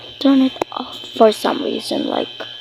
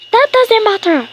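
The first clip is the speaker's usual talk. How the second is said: high-pitched cross mom voice; impatient mock telling-off; pretend scolding; 10 semitones higher, 4 dB louder than usual. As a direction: cut off the excuse as a play-acted mother. Play mother scolds dawdling child.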